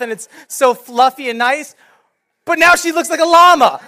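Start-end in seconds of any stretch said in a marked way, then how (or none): 2.4-3.8 loud, high punchline; comic outcry. Real, not acted. Pastor showing the congregation his shorn dog, loud comic dismay.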